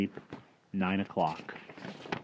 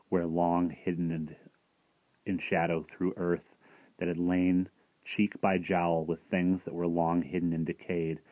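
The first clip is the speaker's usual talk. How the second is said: usual speaking voice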